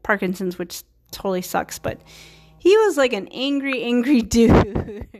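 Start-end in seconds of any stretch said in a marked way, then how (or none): 3.3-5.1 breaking into a laugh on the last word; slow down and let a real laugh take over. Real, not acted. Speaker describing his difficult former client to viewers, laughing. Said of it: "I'm laughing".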